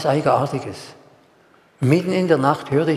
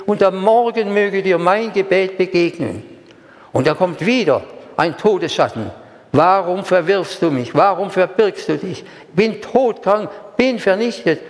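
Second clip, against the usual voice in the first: raised, earnest prayer reading; Gebet vorgelesen; 5 semitones higher than usual. Acted. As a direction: read the psalm as a heartfelt plea. Reads psalm prayer aloud. (feierlich vorgetragenes Gebet)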